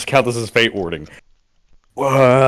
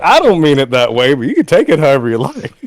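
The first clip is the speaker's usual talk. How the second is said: put-on Southern accent; 2 semitones higher, 3 dB louder than usual. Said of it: "making him Southern".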